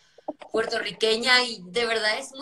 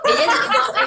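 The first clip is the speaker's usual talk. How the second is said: excited correction; 4 semitones higher, 11 dB louder than usual. Real, not acted. Correcting co-host with a laugh.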